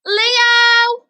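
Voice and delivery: loud, high call across the room; shouting a name. Acted. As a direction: call him out loud and bright. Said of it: "shout his name loud enough".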